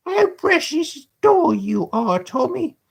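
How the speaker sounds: put-on slow, high, bored boy; high Dodger voice. The Dodger teasing Tommy, high and slow. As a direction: drag it out, high and scornful.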